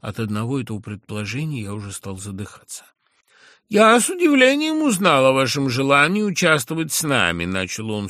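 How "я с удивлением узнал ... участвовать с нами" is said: high, loud, lisping and drawn-out; a condescending lisp, stretching the words; lisping drawl. A narrator's reading. A lisping drawl to the old schoolmate he now talks down to. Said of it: "сюсюкивая и пришепётывая, и растягивая слова".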